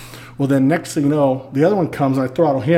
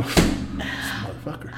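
slow, much softer, under the breath; muttered curse; 8 dB quieter, much slower than usual. Retold or imitated. About his useless brother, slow muttered curse under the breath. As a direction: grumble it to yourself. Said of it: "And I'm".

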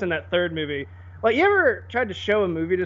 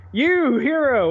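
mock fantasy hero; 7 semitones higher, 5 dB louder, slower than usual. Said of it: "I don't know how they fucking talk".

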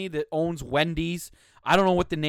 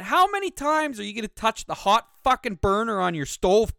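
higher, louder exasperated rant; incredulous rant; 6 semitones higher, 4 dB louder than usual. Real, not acted. Host berating Carl for repeat complaints, higher exasperated rant. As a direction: let the frustration boil over at Carl.

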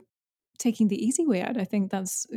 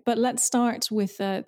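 ordinary talk

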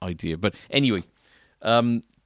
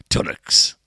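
put-on Tunnocks in a northern English accent; 5 dB louder than usual. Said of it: "I'll do it in a northern accent".

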